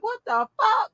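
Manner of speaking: high, shocked exclamation; shocked friend. Retold or imitated. Mocking her friend's shocked reaction, high and surprised. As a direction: mimic her stunned outburst. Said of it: "You like".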